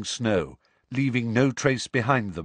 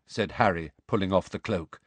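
ordinary delivery, nothing marked

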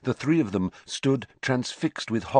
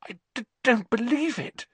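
put-on shocked girl's voice. A narrator's reading. Hermione in shock after execution.

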